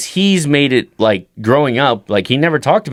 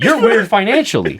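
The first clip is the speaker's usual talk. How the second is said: higher, laughing retort; high laughing put-down; laughing jab; 11 semitones higher than usual. Retold or imitated. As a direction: tease dad through a laugh. Son mocks dad.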